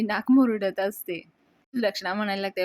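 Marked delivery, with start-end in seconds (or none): none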